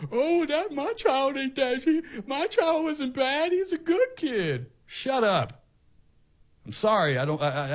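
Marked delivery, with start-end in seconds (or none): 0.1-4.7 high, drawn-out whining mock parent; hammed-up parent defending their child in a high voice; protesting parent. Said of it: "you're gonna have parents running around". 4.9-5.6 higher-pitched, exasperated cut-off; fed-up retort. Real, not acted. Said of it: "I hate to talk like that".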